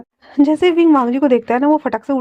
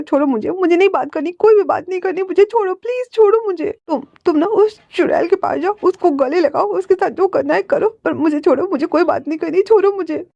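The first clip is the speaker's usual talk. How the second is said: much higher, tearful and insistent; upset pleading; 6 semitones higher than usual. A narrator's reading. Wing pulling free from Wangji, high and tearful. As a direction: beg to be let go, voice climbing high.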